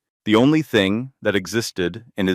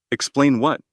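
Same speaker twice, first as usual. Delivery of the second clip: angry; 6 semitones higher than usual. Acted. Girlfriend's furious outburst.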